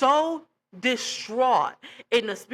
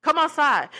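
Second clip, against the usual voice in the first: challenging callout; 5 semitones higher, 4 dB louder than usual. Retold or imitated. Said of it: "my flesh took over for a split second".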